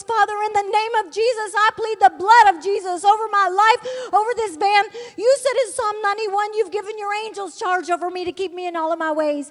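loud, high, pleading cry to God; screaming prayer. Retold or imitated. Woman in danger screaming her prayer to God, high and loud. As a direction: scream the plea at the top of your voice. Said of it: "I began to scream at the top of my voice".

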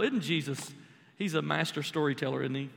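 usual speaking voice

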